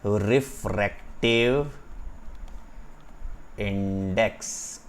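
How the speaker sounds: "Refractive ... index" stretched, with a long pause between; slow term drill. Shown as a demonstration. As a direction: say "refractive index" slowly, word by word. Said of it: "क्या बोलता हूँ मैं?". ("Refractive index" धीरे, रुक कर)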